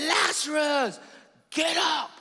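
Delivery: high, loud shout of the name; shouting the dead man awake; commanding shout. Retold or imitated. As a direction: bark the order at the tomb, drawing out Lazarus. Jesus commands Lazarus.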